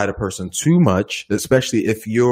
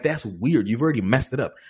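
ordinary talk

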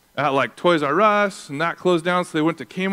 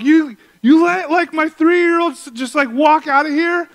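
incredulous yell; 12 semitones higher, 7 dB louder than usual. Retold or imitated. Father yells at the friend who let his son leave, high incredulous shout. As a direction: shout it in shock at a careless friend. Pastor retells yelling at buddy.

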